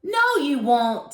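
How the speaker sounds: high, loud, slow defiant shout; Tommy shouting back at Darren. A narrator's reading. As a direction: shout him down. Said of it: "Tommy shouted".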